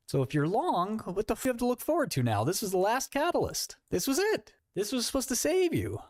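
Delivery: a hint of a desperate bagholder; mock panicked bagholder. Mocking quantum bagholders.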